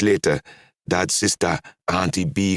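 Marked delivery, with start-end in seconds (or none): none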